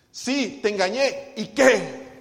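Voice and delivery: loud, slow defiant admission; defiant shout. Retold or imitated. Wife finally yelling her confession at her husband. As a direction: throw it in his face. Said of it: "ella le dice".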